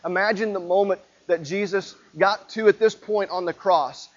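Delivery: normal talking voice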